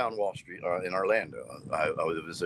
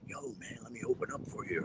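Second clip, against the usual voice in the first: soft unvoiced ask; whispered imagined plea; 12 dB quieter than usual. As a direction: whisper it like a wish.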